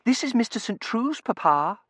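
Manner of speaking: high, loud daughter interceding; high-pitched appeal to Papa; hurried intercession. A narrator's reading. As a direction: jump in anxiously in a high pitch. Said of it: "Tossie interceded hurriedly".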